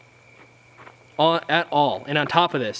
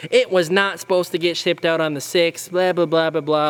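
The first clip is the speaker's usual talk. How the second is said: whiny dad denial; 4 semitones higher than usual. Retold or imitated. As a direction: deny it like a whiny, defensive dad. Imitating the dad arguing back.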